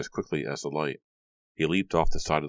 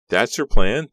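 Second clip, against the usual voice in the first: much louder, higher outcry; incredulous cry; 7 semitones higher, 11 dB louder, slower than usual. A narrator's reading. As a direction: blurt it out in disbelief. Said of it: "Della cried".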